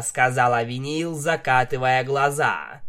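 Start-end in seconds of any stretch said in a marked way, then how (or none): none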